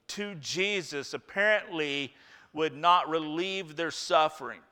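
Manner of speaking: ordinary talk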